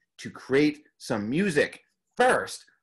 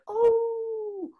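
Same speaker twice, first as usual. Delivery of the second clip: small high wolf cub howl. Shown as a demonstration. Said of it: "like a little, little wolf cub".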